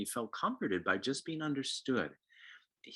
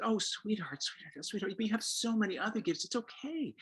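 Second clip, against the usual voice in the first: soothing mom; 11 semitones higher than usual. Retold or imitated. Mom soothing her upset son, high and cooing. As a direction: coo to a hurt child.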